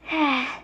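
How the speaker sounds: short, audible breathy sigh; acted sigh. Demon Lord turns to address Kumo, acted sigh. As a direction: heave a brief sigh before speaking.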